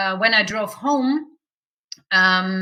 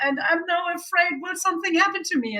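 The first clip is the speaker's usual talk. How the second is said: much higher, anxious fast question; high, fast, worried plea; frightened; 9 semitones higher than usual. Retold or imitated. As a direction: rush it out high and scared. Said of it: "I was so afraid".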